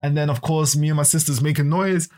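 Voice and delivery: ordinary delivery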